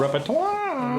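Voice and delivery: high silly voice. Pretend. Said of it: "repeated mock takes".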